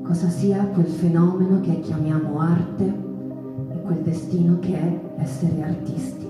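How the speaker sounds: ordinary talk, nothing marked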